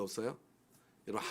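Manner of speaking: ordinary delivery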